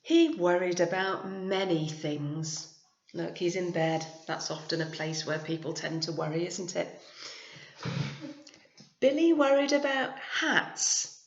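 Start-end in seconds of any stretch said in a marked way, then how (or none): 0.0-2.7 put-on clear, steady storytelling voice for children; gentle teacher reading a story aloud; story narration. A narrator's reading. Reads picture book aloud. 9.0-11.2 story narration. A narrator's reading. Reads picture book aloud.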